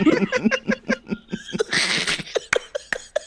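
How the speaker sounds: breathless high cackle; maniacal goblin cackle. Retold or imitated. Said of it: "and laughing maniacally".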